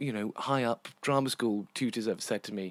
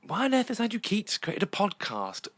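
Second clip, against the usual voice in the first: higher-pitched sceptical questioner; mock incredulity; 8 semitones higher than usual. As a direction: voice a baffled critic, pitched up. Voicing imagined sceptical listeners.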